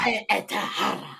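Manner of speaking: mock Spanish twang. Co-host imitating the killer's line, mock Spanish twang. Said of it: "he had a little Spanish twang when he got mad".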